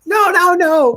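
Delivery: high, loud panicked no's; screaming protest. Retold or imitated. Robot protesting as the button gets pressed, screaming. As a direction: imitate a robot suddenly shrieking in alarm. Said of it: "And she's like".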